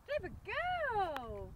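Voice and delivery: high, slow doggy praise. Real, not acted. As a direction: coo it to the dog slowly. Praising dog like a baby.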